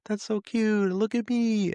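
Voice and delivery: put-on very high, cooing child's voice; high-pitched, sing-song cooing; awestruck little daughter. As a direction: imitate a delighted little girl. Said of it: "she's going".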